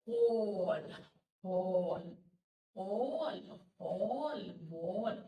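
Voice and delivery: "কল" and "বল" with the vowel stretched; long "অ" vowel. Shown as a demonstration. Teacher giving examples, long "অ" in "কল", "বল". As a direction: draw out the rounded vowel in "কল". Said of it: "long ভাবে টেনে বলছি".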